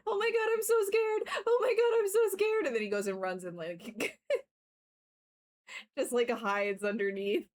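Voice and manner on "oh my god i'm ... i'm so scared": high, loud mock-terrified dog voice; hammed-up frightened pet, much higher pitched; dramatic scared dog. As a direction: play the dog as a drama queen, squeaky with fake fear. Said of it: "He's so dramatic, like".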